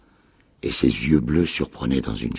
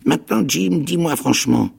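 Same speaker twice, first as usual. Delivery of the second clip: menacing castaway; 12 semitones higher than usual. A narrator's reading. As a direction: press hard, finger raised in warning. Ben Gunn voice.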